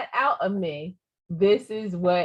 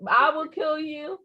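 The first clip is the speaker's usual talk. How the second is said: higher-pitched, playful no-nonsense warning; mock stern threat; 7 semitones higher than usual. Mother warning her child off backtalk, mock stern. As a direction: lay down the law, half in jest.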